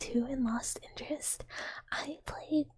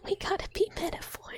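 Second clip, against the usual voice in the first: excited repeat; 8 semitones higher than usual. Acted.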